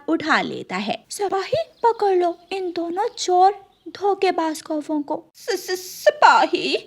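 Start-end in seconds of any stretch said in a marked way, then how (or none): 1.1-5.2 a small son warning his mother gently; little chick voice. Acted. 5.3-6.8 stammering fright. Acted. Crow wife, caught red-handed, stammers to soldier.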